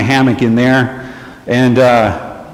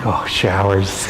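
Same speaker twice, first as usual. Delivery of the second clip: soft awe; 6 semitones lower, 6 dB quieter, slower than usual. Retold or imitated. Reacting to the offer of showers.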